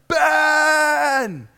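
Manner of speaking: long, high, loud cry; acted anguished holler; last desperate yell. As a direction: shout the name, drawn out, with everything left. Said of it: "he yells out one last time".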